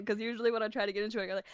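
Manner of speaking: ordinary talk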